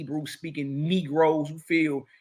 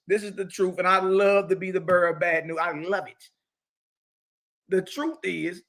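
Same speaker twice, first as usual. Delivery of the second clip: ordinary talk, nothing marked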